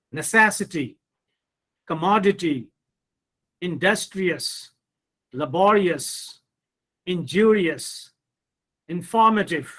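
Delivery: neCESsity, comMODity, inDUStrious, laBOrious, inJUrious, inFORmative; third-from-end stress. Shown as a demonstration. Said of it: "put stress on the third syllable".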